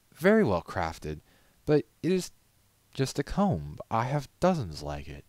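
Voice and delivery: high, loud grand lady conceding; haughty lady voice. Acted. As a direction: grant it grudgingly, nose in air. Rarity voiced, dismissive.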